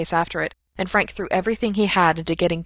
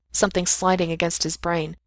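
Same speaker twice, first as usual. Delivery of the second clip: plain reading voice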